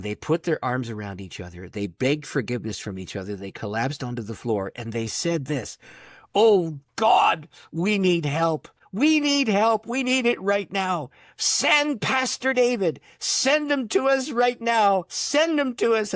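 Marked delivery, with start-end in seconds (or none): 6.3-16.1 high, loud pleading to heaven; acted-out urgent plea, pitched up; desperate prayer. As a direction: cry out for help, stretching the words. Acts out couple's desperate prayer.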